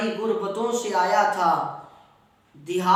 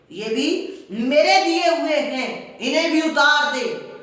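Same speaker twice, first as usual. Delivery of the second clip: high, loud, harsher repeat on میرے; escalated angry shout; 7 semitones higher, 6 dB louder than usual. Retold or imitated. Uncle demanding the clothes back, escalated furious shout. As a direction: hammer میرے the second time, louder and higher.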